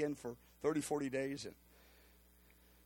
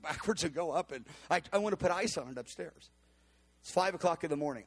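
ordinary talk, nothing marked

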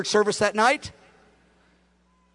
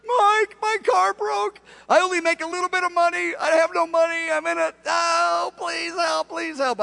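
much higher, pleading voice; retold desperate plea, higher pitched; pleading single mom; 8 semitones higher than usual. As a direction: imitate a desperate mom begging.

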